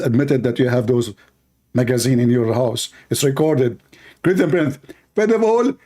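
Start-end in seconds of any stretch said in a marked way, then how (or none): 4.2-5.7 high, strained mock preacher voice; squeaky pompous debater, much higher; mocking caricature of a rival debater; Zakir Naik. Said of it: "Zakir Naik, this is your voice".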